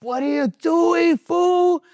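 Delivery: high, drawn-out incredulous shout; slow yell climbing very high and loud; shouted self-reproach. Retold or imitated. User yelling at himself after flushing the sack, incredulous shout.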